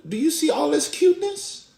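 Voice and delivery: put-on very high squeaky baby voice; toddler son. Toddler telling dad to admire his cuteness, high mock baby voice. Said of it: "I know he's like".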